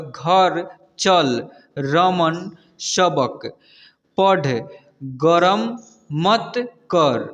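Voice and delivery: slow, firm teacher's reading, long gaps between words; measured dictation-style reading; word-by-word reading demo. Shown as a demonstration. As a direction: stretch each word for beginning readers. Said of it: "इसको कैसे पढ़ते हैं".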